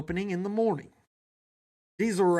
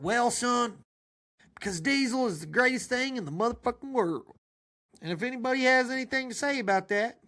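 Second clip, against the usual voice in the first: proud daddy; 6 semitones higher than usual. Acted. Father bragging about diesel to his sons, raised voice. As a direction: brag to your son. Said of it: "He said".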